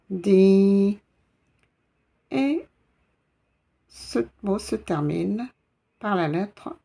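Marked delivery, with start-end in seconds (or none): 0.1-1.0 « Di » plus fort avec un i long. Shown as a demonstration. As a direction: tenir le i de « Di ». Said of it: "je prononce".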